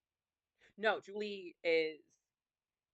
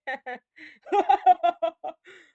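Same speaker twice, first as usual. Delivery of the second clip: loud, high hammed-up laughter; acted belly laugh; 12 semitones higher, 17 dB louder than usual. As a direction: burst into a big performed guffaw. Game character laughs at own joke.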